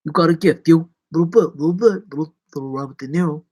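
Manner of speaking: rough stab at a famous-actor impression; lightly voiced movie tough guy; half-hearted celebrity impression; Robert De Niro. Shown as a demonstration. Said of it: "My impressions are".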